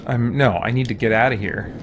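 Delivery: normal talking voice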